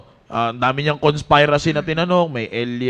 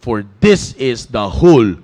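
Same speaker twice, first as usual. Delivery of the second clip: scripture reading; 3 semitones lower, 5 dB louder, much slower than usual. Acted. Quoting the Bible's conclusion.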